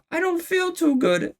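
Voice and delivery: very high, squeaky little elf voice; high-pitched cartoon elf; queasy elf. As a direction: squeak it out in a tiny falsetto. Elf's last words before exploding.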